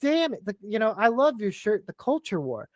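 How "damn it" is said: hyped rant. Real, not acted. Hyped pro-war rant.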